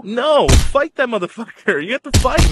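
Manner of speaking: high, forceful rallying cry; fired-up urging. Real, not acted. Telling listeners not to take a no, fired-up urging.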